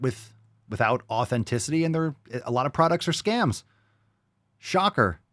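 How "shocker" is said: sarcastic. Real, not acted. Mocking fake surprise.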